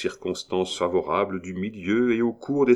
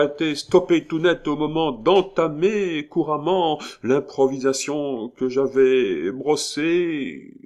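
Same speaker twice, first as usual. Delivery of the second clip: higher-pitched, laboured speechifying; flustered pompous orator; 5 semitones higher, 4 dB louder than usual. A narrator's reading. Militaire bungling his prepared tribute, flustered and pompous. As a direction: declaim like an amateur orator losing the thread.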